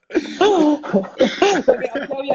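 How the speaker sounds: words squeezed out mid-laugh; laughing through words. Real, not acted. Still laughing with his mate, getting back to the story. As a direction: keep laughing as you start again.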